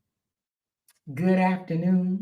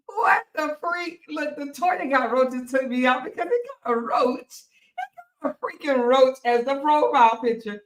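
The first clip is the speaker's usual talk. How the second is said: high-pitched, amused disbelief; 6 semitones higher than usual. Real, not acted. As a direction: exclaim high, tickled and incredulous. Amazed at roach profile picture.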